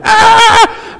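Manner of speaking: high mock scream of a frazzled wife; a squealed cry of panic, played for laughs. Wife in the nursery overwhelmed by sugared kids, mock scream. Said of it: "my wife is down there going".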